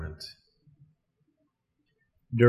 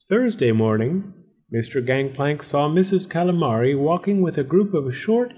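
ordinary delivery

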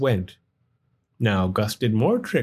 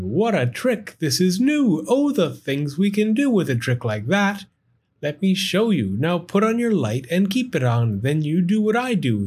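higher, eager storybook character; excited character voice; 6 semitones higher than usual. Acted. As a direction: read it like an excited kid. Sam excited about firefly trick.

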